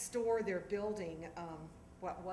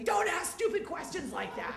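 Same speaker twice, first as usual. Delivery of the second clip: much higher, louder mock bark with a laugh; barking dad; 10 semitones higher, 8 dB louder than usual. Father to daughter at breakfast, half-laughing mock bark. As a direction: snap the kid down, hammed-up. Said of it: "He barks at me".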